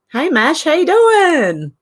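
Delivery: slow, high stretched greeting; sing-song greeting. Real, not acted. Greeting Mash in the chat, slow and high. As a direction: draw it out brightly to a friend.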